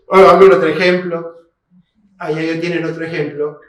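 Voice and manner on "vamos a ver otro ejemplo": usual speaking voice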